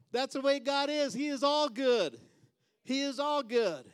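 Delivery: high, loud, ringing proclamation; full-force preaching. Real, not acted. Preacher declaring God's goodness to the congregation, full-force preaching. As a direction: cry it out to the whole church.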